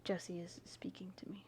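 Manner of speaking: whisper. Real, not acted. Aside about the cat.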